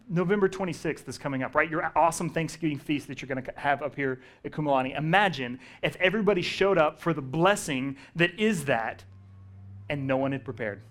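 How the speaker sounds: ordinary delivery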